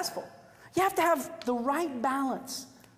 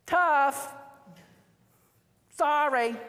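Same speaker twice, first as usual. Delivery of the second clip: blunt dismissal; 4 semitones higher, 5 dB louder, much slower than usual. Pretend. Imagined blunt reply to unhappy bride.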